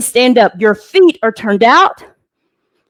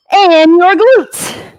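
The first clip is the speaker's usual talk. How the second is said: emphatic coach cue; 5 semitones higher, much slower than usual. Real, not acted. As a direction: punch the last muscle group out. Coach cueing target muscles.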